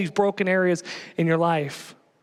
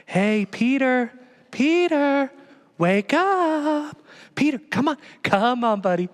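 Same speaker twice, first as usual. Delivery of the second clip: a lightly higher, gentle coaxing voice; soft, gentle Jesus; 7 semitones higher than usual. Pretend. Preacher imitating a soft Jesus waking Peter, gentle and coaxing. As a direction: coax him awake tenderly. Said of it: "Very soft and gentle".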